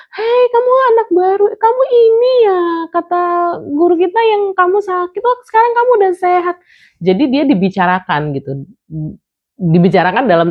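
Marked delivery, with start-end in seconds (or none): 0.1-6.5 put-on much higher, excited children chattering; kids' voices. Mother imitating classmates greeting her daughter, high and excited. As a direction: squeal it like eager schoolkids. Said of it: "teman-teman kayak".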